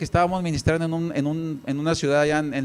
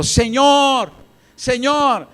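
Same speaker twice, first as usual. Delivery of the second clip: high, raised cry for attention; retold shouting; 7 semitones higher, 4 dB louder, much slower than usual. Woman calling to the Lord for help, shouting. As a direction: cry out high, drawing out the name. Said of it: "Esta mujer ahora estaba gritando".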